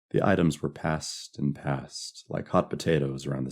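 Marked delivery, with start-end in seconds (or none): none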